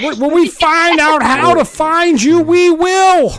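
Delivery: a cartoon villain vowing revenge; mock threat. Host mock-threatening the thumbs-down trolls, squeaky villain.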